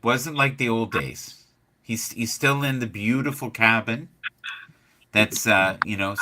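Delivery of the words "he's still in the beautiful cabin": ordinary delivery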